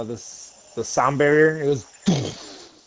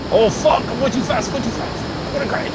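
high-pitched panic, repeating in alarm; panicked yelp; 10 semitones higher than usual. Real, not acted. Pilot in a game about to crash, high panicked cries. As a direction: blurt it high, losing control. Losing control of the game plane.

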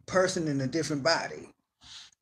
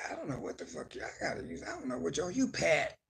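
ordinary talk

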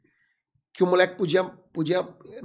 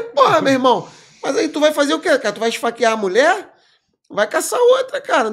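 loud, high, laughing disbelief; laughing scolding; 8 semitones higher, 7 dB louder than usual. Retold or imitated. Cop laughing at the kid over the cheating wife, loud laughing scold. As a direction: scold him while laughing.

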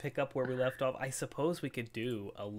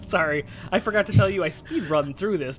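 loud, higher rushed shout; 6 semitones higher, 11 dB louder than usual. Real, not acted. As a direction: blurt it out loud. Outburst during game.

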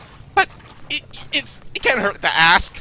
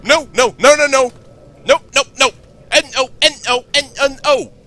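higher, louder panicked rush of no's; rising alarm as danger nears; real panic; 6 semitones higher, 4 dB louder than usual. Real, not acted. Monster sound, one match left.

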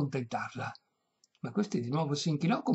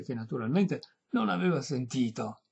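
plain reading voice

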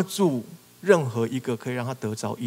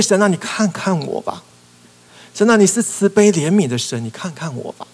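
louder, higher plea to God; loud pleading praise; 5 semitones higher, 8 dB louder than usual. Retold or imitated. Blind man loudly pleading for God to look. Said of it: "他是在赞美神".

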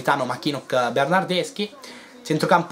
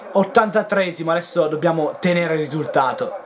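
ordinary delivery

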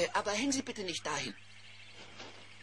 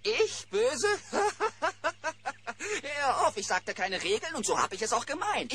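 higher laugh, then words through laughter; 5 semitones higher than usual. Acted. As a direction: laugh away the boy's worry. Gasto laughs off the mess.

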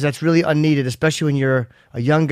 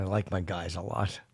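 low, hushed, creepy imitation of his cohost; creepy mock echo; 9 semitones lower, 15 dB quieter than usual. Host teasing his cohost by mimicking him creepily. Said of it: "You said".

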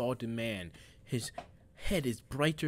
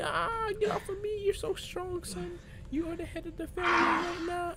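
father whining in a much higher voice; high whiny father. Retold or imitated. Son voicing his beaten father, high and whiny. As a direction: whimper it high and defeated. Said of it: "And he's like".

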